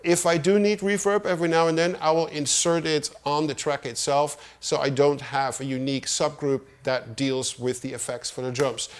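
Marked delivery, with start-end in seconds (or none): none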